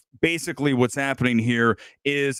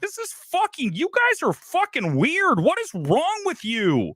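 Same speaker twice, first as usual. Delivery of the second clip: much higher, exasperated retort at the audience; retold outburst; 12 semitones higher than usual. Reviewer replays snapping at his laughing theater audience, high exasperated outburst. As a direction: replay your outrage at the laughing crowd, pitched way up. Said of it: "I'm like".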